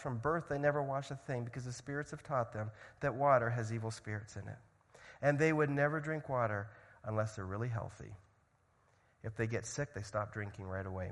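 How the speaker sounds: ordinary talk, nothing marked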